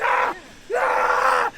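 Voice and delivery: high, loud wordless shriek; hysterical scream. Retold or imitated. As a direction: scream over the person shaking you. Kid still freaking out while shaken.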